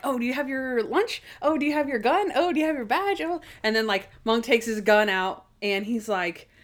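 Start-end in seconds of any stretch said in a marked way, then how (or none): 0.0-3.4 high, giddy mimicry of excited questions; high-pitched giddy retelling; giddy excitement. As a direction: imitate someone giddily fussing, pitch climbing. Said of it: "Like, they are giddy".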